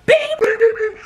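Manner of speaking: very high, rapid imitated hits; squeaky punch noises. Shown as a demonstration. Storyteller acting out the fight, squeaky mock punches. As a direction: punch out little high sound effects.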